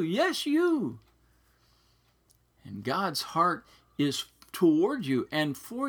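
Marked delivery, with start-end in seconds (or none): 0.0-1.0 much higher, insistent "Yes, you"; emphatic lift. Real, not acted. Pastor urging each listener personally, emphatic and high.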